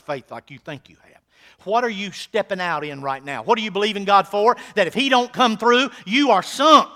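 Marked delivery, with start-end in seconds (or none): 1.6-4.6 loud, high, fervent pulpit preaching; preaching at full force. Acted. 4.7-6.9 louder and higher, rising on "don't"; full-force preacher's challenge; loud repeat. Acted.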